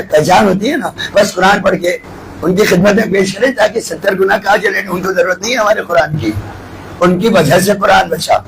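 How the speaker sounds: ordinary talk, nothing marked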